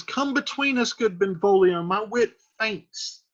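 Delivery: high-pitched, hammed-up appeal; playful plea. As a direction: call a friend in to rescue you. Acts Mercutio begging Benvolio for help.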